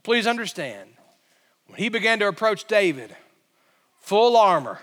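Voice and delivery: ordinary talk, nothing marked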